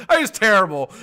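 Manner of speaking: high, loud, laughing through it. Real, not acted. After mocking the layoff, laughing at himself. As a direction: crack up at your own bit. Laughs at his own joke.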